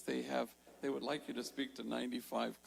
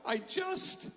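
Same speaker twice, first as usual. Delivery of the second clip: a much higher, sudden blurt, then cut off; the words escape before he can stop them; 4 dB louder than usual. Retold or imitated. Mario blurts to the crowd, high and abrupt, then breaks off. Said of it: "suddenly he blurts out".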